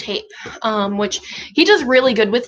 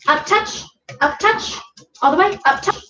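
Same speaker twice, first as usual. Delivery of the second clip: high rising up touch cue; talking to a dog; 8 semitones higher than usual. Real, not acted.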